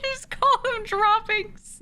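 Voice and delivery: high, slow, helpless with laughter; laughing. Real, not acted. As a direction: squeeze it out through laughter. Cracking up at joke name.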